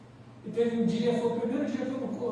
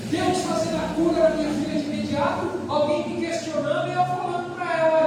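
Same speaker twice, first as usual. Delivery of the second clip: raised, high, forceful pulpit voice; impassioned preaching; 6 semitones higher, 7 dB louder than usual. Real, not acted. Preacher marvels at parents doubting God's healing, loud impassioned preaching. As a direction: preach it loud and high, driving the point home.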